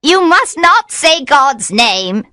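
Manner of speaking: high, loud witch voice, slow; the witch warns him urgently; witch pleading. A narrator's reading. Witch voice in story.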